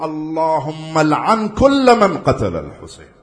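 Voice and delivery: loud, slow and deliberate recitation of the curse; slow solemn curse. Acted. Preacher reciting the curse to the crowd, slow and loud. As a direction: spell it out word by word.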